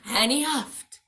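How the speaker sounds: ordinary delivery, nothing marked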